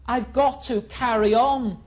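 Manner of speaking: loud, high, aggrieved protest; Jeremiah complaining. Acted. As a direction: push back, it's unfair. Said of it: "Jeremiah says".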